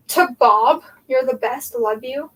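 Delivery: normal talking voice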